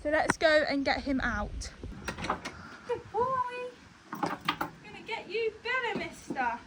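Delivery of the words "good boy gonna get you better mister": soft horse talk. Real, not acted. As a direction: gently coo to a nervous horse. Soothing her horse.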